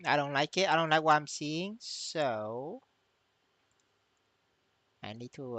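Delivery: usual speaking voice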